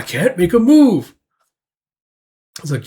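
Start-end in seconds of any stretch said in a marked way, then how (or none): 0.0-1.1 mock frustrated cry. Acted frustration while fidgeting.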